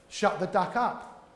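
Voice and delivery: slow, deliberate; slow, deliberate advice; slow punchline. Retold or imitated. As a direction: lay it down word by word. Psychologist's punchline slowed.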